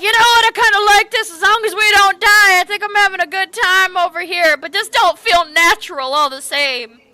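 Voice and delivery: Chris, loving the scary ride; excited Chris. Acted. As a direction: babble happily through the fear. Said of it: "You're like, Chris".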